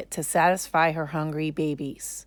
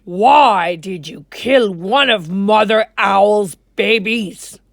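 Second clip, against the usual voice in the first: much louder, booming call; king's voice; 3 semitones higher, 11 dB louder than usual. Acted. King Lion calling the monkey, booming. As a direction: summon him like a stern king. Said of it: "said the king".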